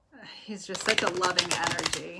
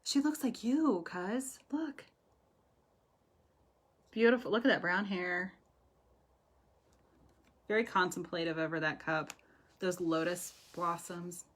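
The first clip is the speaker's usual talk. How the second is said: ordinary talk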